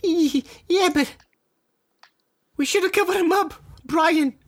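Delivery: put-on high squeaky little boy; high kid voice.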